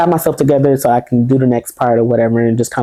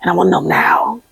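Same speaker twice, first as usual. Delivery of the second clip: urgent retold whisper, breathless and secret; a hushed, itching whisper; 7 semitones higher than usual. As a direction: whisper it like you're sneaking. Sneaking a look at test results.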